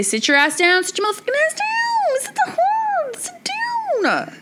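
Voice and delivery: escalating mock yell. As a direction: build from firm order to near-screeching frustration. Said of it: "if I say".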